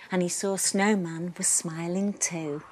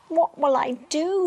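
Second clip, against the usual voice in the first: higher and a bit louder, lost little rabbit's fret; worried cry; 7 semitones higher, 4 dB louder than usual. A narrator's reading. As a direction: cry out, mildly scared. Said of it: "he cried".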